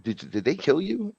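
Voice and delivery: retelling a shaken plea to a ghost; alarmed question. Retold or imitated. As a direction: ask it in a rising panic. Retold alarm at dead hero.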